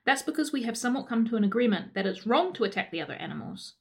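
ordinary talk, nothing marked

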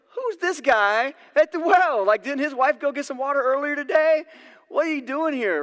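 a startled, nosy villager's voice; suspicious woman. Retold or imitated.

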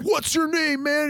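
high, projected call from the stage; hammed-up country star calling out. Singer singling out a fan, high stage call. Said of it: "I, I'm [name]".

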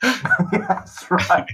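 laughing through the words. Real, not acted. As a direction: chuckle while bragging. Laughing in character.

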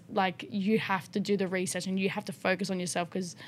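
usual speaking voice

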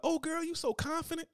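high, sugary mock friend voice; fake compliment. Friends fake-praising a badly dressed wife, high sugary gush. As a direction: gush like a fake-nice girlfriend. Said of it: "Her friends gonna be like".

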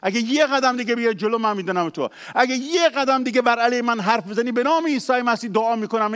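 loud, high hammed-up yell at the enemy; hammed-up angry shout; mock shout. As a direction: bellow it down like a mock Moses. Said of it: "نمیگه موسی از این بالا داد زد".

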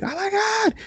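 high-pitched mock shock; mock excited gasp. Telling a friend how she'd react last season, squealing. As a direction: shriek it in playful disbelief. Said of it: "I'd be like".

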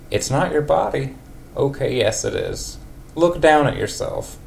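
put-on high wavering old lady voice. Old lady Marge answers Ned after their night. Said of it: "old lady for Marge".

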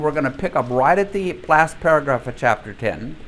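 ordinary talk, nothing marked

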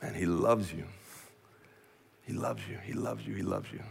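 soft, falling away to very quiet; hushed reassurance. Real, not acted. Preacher reassuring the church of God's love, hushed. Preacher lets the message sink in.

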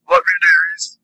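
sing-song sign-off. Real, not acted. Affectionate sign-off.

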